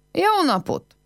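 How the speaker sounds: high call of greeting across the yard; a bright, high hello shouted into the yard. A narrator's reading. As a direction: call out to an empty house. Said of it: "Elkiáltotta magát az udvaron".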